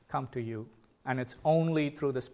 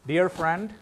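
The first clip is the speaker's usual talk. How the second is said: normal talking voice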